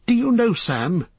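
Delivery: high-pitched, startled hobbit; Frodo, indignant. Acted. Frodo to Sam after nearly drowning, high and indignant. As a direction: pitch it well up, freshly woken and put out.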